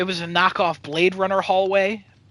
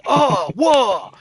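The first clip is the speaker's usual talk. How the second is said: mimicking actors' silent reactions; 6 semitones higher, 5 dB louder than usual.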